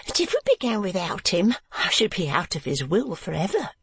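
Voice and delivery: high, anxious lady's voice; worried about the inheritance; fussy wife. A narrator's reading. Wife fusses about uncle.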